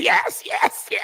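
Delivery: squeaky mimicked yeses; mock phony agreement. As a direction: parody women gushing together. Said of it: "Who talks like this?".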